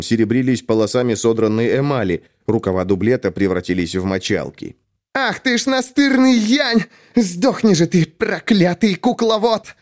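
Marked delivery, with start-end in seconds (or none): 5.1-9.8 high, slow furious cursing; furious shriek. A narrator's reading. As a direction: spit the curse at the giant. Tsellia curses while fighting.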